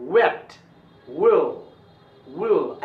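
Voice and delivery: wet, will with an "oo"-like w onset; rounded W. Shown as a demonstration. Teacher demonstrates lip shape for W, rounded wet and will. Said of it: "ऐसे होठ हो जाना चाहिए".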